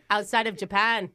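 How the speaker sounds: broad, badly faked Aussie; put-on Australian accent. Prankster caught out by interviewer, sudden fake Australian accent. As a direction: switch to hammy Australian. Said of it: "Are you from Australia?".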